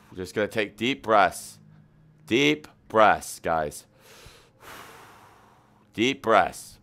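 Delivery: low, soft breathing guide; calm them down slowly; guided meditation. Acted. Leads meditation.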